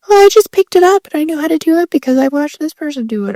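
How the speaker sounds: high, fast mocking imitation; mock know-it-all voice. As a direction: rattle it off in a high mimicking voice. Mocking someone explaining themselves.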